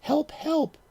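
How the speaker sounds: high, panicked plea for help; frightened cry for help. A narrator's reading. Tiny monster dolls shouting for help, panicked. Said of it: "imagined the monster family shouting".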